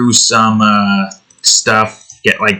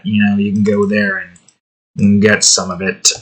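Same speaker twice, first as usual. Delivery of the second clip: usual speaking voice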